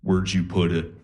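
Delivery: low, eerie monotone; deadpan spooky sleepwalker voice; a low, haunted murmur; creepy sleep-talk voice. Retold or imitated. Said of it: "she just turns to me and goes".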